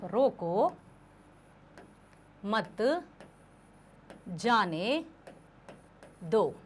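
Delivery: "रोको ... मत ... जाने ... दो", each word alone with a long pause after it; बिना विराम चिह्न, शब्द-शब्द अलग. Shown as a demonstration. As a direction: read word by word, softly, evenly spaced, no phrase grouping. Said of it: "बिना विराम चिन्हों के, देखना आप". (हर शब्द के बाद लंबा ठहराव, धीमे से "रोको", "मत", "जाने", "दो")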